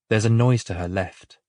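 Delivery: usual speaking voice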